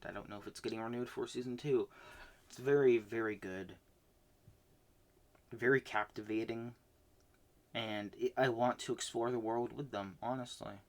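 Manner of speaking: ordinary talk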